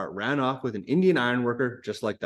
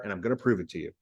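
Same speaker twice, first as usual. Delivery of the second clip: usual speaking voice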